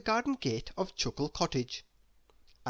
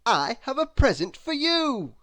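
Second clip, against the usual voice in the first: higher, louder and eager; excited announcement; 10 semitones higher, 7 dB louder than usual. A narrator's reading. Mister Happy calls through the door, eager. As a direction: tempt her with a present.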